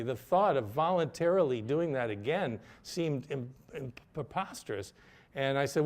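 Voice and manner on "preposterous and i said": usual speaking voice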